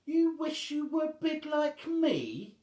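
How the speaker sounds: very high, loud, slow disbelief; stunned she could be envied; shocked. Acted. Wobbling shocked by the boy's wish, high and slow. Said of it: "asked Wobbling, overcome with shock".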